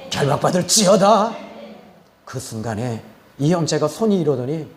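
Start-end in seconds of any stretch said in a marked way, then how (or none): 0.1-1.3 raised, loud exorcist's decree; emphatic preacher's command at full voice; forceful repeated command. Retold or imitated. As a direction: drive the binding order home, voice lifting at the end. Same command repeated with more force.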